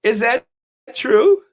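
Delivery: high, loud incredulous question. Pretend. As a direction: ask it in loud mock disbelief. Mock-incredulous repeat of question.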